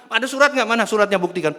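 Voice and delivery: loud, high-pitched demand for proof; acted quarrelsome shout; challenging demand.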